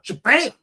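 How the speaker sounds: high, punched "Shabam"; imitated impact. Preacher imagining passing out, mock thud. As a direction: burst out a mock crash noise. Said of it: "Maybe I'll just fall straight down".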